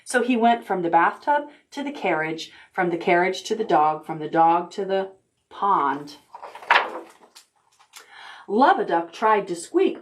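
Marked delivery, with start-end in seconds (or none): none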